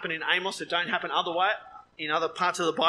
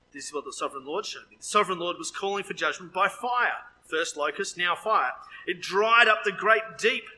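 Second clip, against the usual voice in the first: normal talking voice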